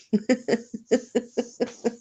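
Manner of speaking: high run of chuckles; helpless giggle at yourself; laughing. Real, not acted. Laughing at herself after admitting the blunder, giggling.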